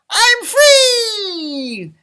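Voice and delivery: high, loud gleeful leprechaun cry. A narrator's reading. Freed leprechaun sings out his escape, high and loud. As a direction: fling it out like a freed prisoner. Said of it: "He sang out".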